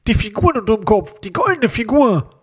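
menacing phone caller disguising his voice; disguised nose-pinched voice. A narrator's reading. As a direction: talk as if holding your nose. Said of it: "als würde jemand die Stimme verstellen".

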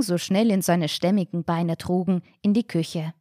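ordinary delivery

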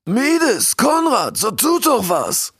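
high, urgent shout to his subjects; king calling out. A narrator's reading. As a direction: cry for action with drawn-out final words. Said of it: "rief der König". (aufgeregt rufender König)

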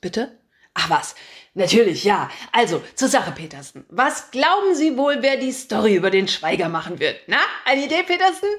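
loud, brash editor barking orders; a pushy chief editor who loves his own voice; booming boss. Acted. Chief editor calling his reporter, booming and pushy.

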